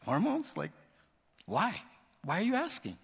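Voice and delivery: baffled, caught off guard; startled recruit answering the officers; taken aback. Retold or imitated. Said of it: "I'm like".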